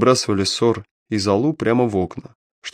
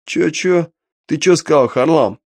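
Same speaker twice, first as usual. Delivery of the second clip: mocking; 6 semitones higher, 3 dB louder, slower than usual. Detective mocks the thug's question.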